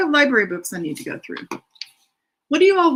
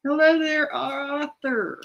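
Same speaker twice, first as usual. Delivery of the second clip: odd greeting voice; 6 semitones higher, slower than usual. Acted. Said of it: "don't know why I said it like that".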